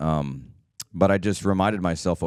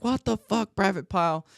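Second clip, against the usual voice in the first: much higher, put-on drill sergeant bark; drill instructor yelling. Drill instructor yelling at a frozen Private Pyle, high mock bark. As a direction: bark at the recruit like Lee Ermey. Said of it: "he was yelling at him".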